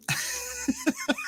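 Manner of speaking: a sudden high laugh bursting out; high burst of laughter. Real, not acted. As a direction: laugh at your own cheek. Laughs after joke.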